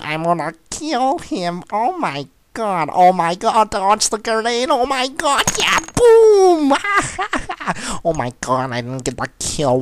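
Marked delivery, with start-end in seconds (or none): 1.7-6.7 high, loud yelling building to a shriek; frantic excitement. Real, not acted. Gamer dodging a grenade mid-attack, frantic rising shriek. 6.8-8.0 very high, gleeful laugh; triumphant cackle. Real, not acted. Gamer crowing after the boom, high triumphant cackle.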